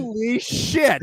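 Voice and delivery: slow, laughing disbelief; stunned laugh. Real, not acted. Host reacting to Bobby Hull's greeting, laughing disbelief. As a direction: laugh it out in shock.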